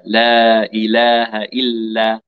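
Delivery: لا إله إلا drawn out slowly and carefully; slow model. Shown as a demonstration. Teacher modelling the phrase slowly for the student. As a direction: give each syllable of لا إله إلا time.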